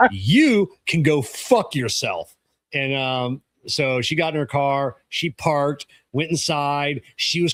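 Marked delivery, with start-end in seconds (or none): 0.1-2.3 every word slow and spaced; slow, deliberate. Retold or imitated. Mechanic telling off the customer, slow and deliberate. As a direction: spell it out slowly, word by word.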